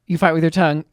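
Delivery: ordinary talk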